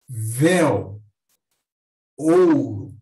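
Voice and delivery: "Véu, ouro" each loud on the first vowel, weaker on the glide; ditongo decrescente. Shown as a demonstration. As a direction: hit the first vowel of "Véu" and "ouro", then let it fall. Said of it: "vogal forte e uma semivogal mais fraca". ("Véu, ouro" com a primeira vogal forte)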